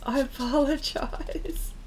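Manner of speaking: breathy giggle through the word; sheepish laughing apology; laughing whispered apology. Real, not acted.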